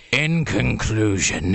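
very slow, low mock voice. Shown as a demonstration. Narrator shows how the book ends up sounding, very slow. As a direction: stretch it out like an aging rocker. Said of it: "by the time he gets to".

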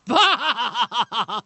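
put-on Austrian accent laughing Bah; a mock Schwarzenegger grunting Bah through a laugh. Arnold turns to the interviewer with an Austrian accent laughing Bah. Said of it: "looks at the interviewer and goes".

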